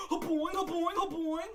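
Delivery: high, loud springy boings; bouncy springy boingy voice. Retold or imitated. Imitating Mario jumping.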